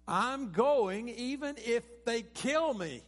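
loud, high, slow declaration; acted-out defiant insistence; really emphatic. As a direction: proclaim it forcefully, stretching the key words. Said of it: "he gets really emphatic with them".

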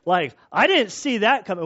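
high, loud amazed exclaim; impressed, delighted Jesus voice. Retold or imitated. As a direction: gush like he's been outplayed. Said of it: "he's like".

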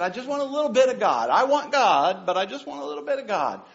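high, quick mock plea; half-hearted believer. Preacher mimicking stagnant believers, half-hearted and high.

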